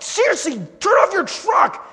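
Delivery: acted-out yell at a noisy truck; exasperated yelling. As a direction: holler each word in frustration. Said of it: "yelling in my car".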